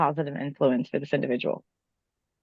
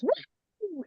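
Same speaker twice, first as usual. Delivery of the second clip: high whoop from the bar crowd; imitating a room-wide cheer; crowd woo imitated; 13 semitones higher, 8 dB quieter than usual. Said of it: "everybody at the bar will just".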